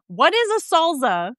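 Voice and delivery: high, incredulous question with "salsa" as "solza"; mock-baffled, pitched well up; mock bafflement. Mock question about dad's word.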